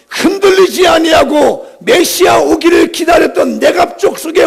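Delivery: high, driving pulpit voice; full-force preaching. Real, not acted. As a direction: preach with urgent, sustained intensity.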